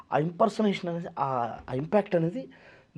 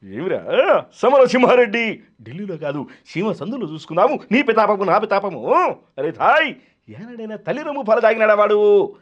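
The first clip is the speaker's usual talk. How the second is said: thundering film-villain challenge, swinging loud; Jayaprakash Reddy; 6 semitones higher, 6 dB louder than usual. Acted. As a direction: roar out threats like a film villain. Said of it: "Jayaprakash Reddy గారిలో చెప్పాడు". (జయప్రకాష్ రెడ్డి గర్జన అనుకరణ)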